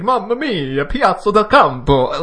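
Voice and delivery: Mamma mia, Piazza del Campo, high, loud and heavily emphasized; overdone fake Italian. Shown as a demonstration. Host mocking his own pronunciation, in an overdone Italian accent. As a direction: ham up the Italian, leaning hard on every syllable. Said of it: "if you're supposed to emphasize that much".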